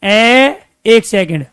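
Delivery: drawn-out vowel sound; short vowel demo. Shown as a demonstration. Teacher sounding out ए for students, slow. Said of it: "देखो उच्चारण करके".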